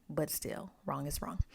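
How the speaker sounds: dry whisper, a wry verdict; whispered quip. Real, not acted. Reviewer judges the father, wry whisper. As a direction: drop to a whisper for the punchline.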